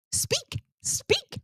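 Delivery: very high, soft mocking call; dog command. Friends mocking the bully like a dog, very high teasing command. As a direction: coo it like teasing a puppy.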